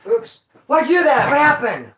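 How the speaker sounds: high, loud panicked yelling; shocked yelling. Retold or imitated. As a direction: yell in shocked disbelief. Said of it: "it was one of those like".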